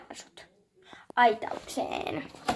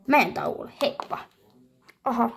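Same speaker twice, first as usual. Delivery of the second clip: normal talking voice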